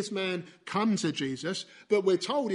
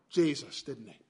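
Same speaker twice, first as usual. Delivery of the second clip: ordinary talk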